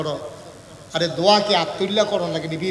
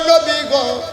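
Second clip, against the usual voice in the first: high, loud plaintive address; imploring call; 9 semitones higher, 7 dB louder, much slower than usual. Retold or imitated. Wife addresses her husband, loud imploring call. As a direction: cry out the name imploringly.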